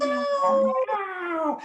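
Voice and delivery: very high drawn-out meow; high pretend cat cry; best meowing. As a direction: yowl like a cat, sliding down at the end. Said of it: "with your best meowing".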